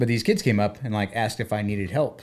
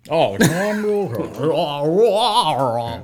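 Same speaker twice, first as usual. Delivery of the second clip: hugely drawn-out, slurred anchorman parody; exaggerated newsman impression, higher and very slow; Tom Brokaw; 5 semitones higher, 5 dB louder, much slower than usual. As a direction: smear the name into long garbled vowels, loud. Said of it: "it's when Jimmy Fallon does".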